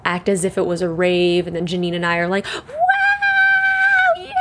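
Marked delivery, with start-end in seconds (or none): 2.5-4.3 party cheer. Retold or imitated. Drunk friends cheering at the living room rave, high whoop.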